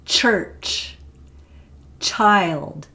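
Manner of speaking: put-on careful "Church", "child" with crisp "ch"; example words. Shown as a demonstration. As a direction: hit the "ch" sounds. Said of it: "that's the voiceless one".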